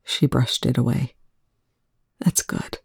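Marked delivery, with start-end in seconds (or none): 2.2-2.8 soft unvoiced whisper. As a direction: whisper it through tears. Ray still whispering, tearful.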